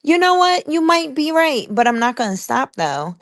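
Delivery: high, sugary mock-polite voice; chirpy fake-nice retort; kindly sweet reply. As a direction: sweetly brush off the doubters. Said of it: "just kindly say".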